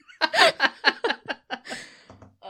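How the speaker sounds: high, loud burst of laughter. Real, not acted. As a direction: crack up completely. Laughing at own remark.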